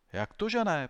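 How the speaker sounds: high, loud indignant pupil; protesting. A narrator's reading. Pupil contradicting the doubting headmaster, protesting. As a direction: protest loudly, pitched right up. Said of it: "protestoval Alfred".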